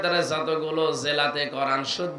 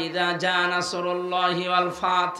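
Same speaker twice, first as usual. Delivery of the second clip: ordinary delivery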